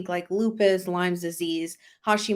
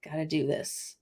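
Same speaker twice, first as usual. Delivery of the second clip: soft, low repeat to yourself; quiet; 4 semitones lower, 9 dB quieter than usual. Retold or imitated. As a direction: murmur it under your breath. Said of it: "And I was like".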